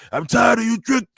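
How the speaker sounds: high-pitched, badly faked Scottish accent; mock an annoyed wrestler in a poor Scottish accent. Podcaster retelling Joe Hendry's ring call-out, bad Scottish accent. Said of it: "I can't do a good Irish voice, Scottish voice".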